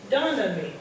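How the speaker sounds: ordinary delivery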